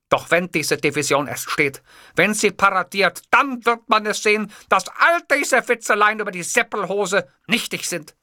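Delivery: rising into a high speech voice; orator's fervour. A narrator's reading. Boss lecturing his secretary on shorts, building oratory.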